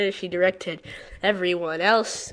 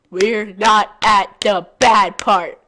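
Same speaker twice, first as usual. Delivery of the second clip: slow emphasis; 9 dB louder, slower than usual. Real, not acted. Repeats the line differently.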